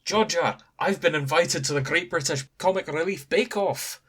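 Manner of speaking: put-on excited husband in a sketchy Scottish accent. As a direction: play David in a half-hearted Scottish accent. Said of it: "do the sketchy accent".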